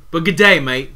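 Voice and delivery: loud, badly faked Australian accent. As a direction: blurt it out as a weak Aussie try. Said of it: "Uh, it wasn't even good".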